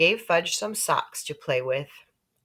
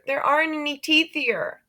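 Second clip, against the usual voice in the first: put-on exasperated girl; 10 semitones higher than usual. A narrator's reading. Sheila exasperated.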